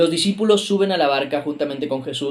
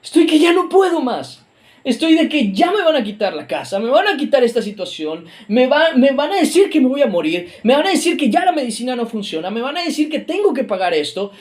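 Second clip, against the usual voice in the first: desperate plea; 8 semitones higher than usual. Acted. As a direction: beg God as if at the end of your rope. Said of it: "le dices".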